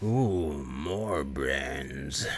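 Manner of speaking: low, drawn-out zombie moan for brains; hungry undead drone, low and slow; zombie groan. Acted. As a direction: groan it slowly, sinking deep like a hungry zombie. Acts out Tar Man zombie.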